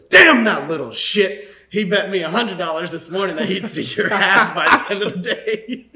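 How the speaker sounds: dad yelling about his son's bet, laughing; furious dad outburst. Acted. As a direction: explode like a cheated father, cracking up. Dad's punchline outburst in joke.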